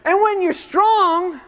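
loud, high, drawn-out proclamation; big, slow rallying cry; triumphant preaching. Real, not acted. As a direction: drive it home slowly and forcefully. Preacher confirms the crowd's shouted answer.

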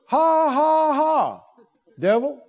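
a put-on laugh aimed at the devil; forced laugh. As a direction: make yourself laugh at the devil. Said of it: "made himself".

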